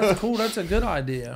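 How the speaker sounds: high, loud, laughing through a fake yes; laughing mock agreement. Retelling how he laughed at the counselor's plan, high and loud. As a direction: laugh it off as if the idea is great.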